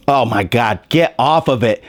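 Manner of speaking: usual speaking voice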